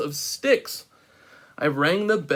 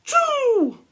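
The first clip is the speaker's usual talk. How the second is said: explosive high achoo; huge sneeze. A narrator's reading. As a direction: let a giant sneeze burst out. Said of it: "I sneezed a great sneeze".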